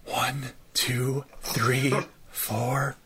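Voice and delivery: deep, soft whispered counting. Acted. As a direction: count under your breath, deep and soft. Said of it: "have, like, this deep".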